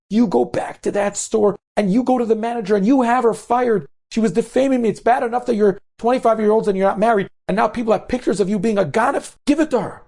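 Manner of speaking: high-pitched, heated tirade; irate demand. Retold or imitated. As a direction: order her indignantly to go settle the score. Upset mother demands revenge from daughter.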